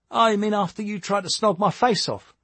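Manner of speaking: high-pitched, playful teasing. A narrator's reading. Tommy reminds Jake of the attempted kiss, teasing. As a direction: needle him playfully, voice lifted. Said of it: "Tommy teased".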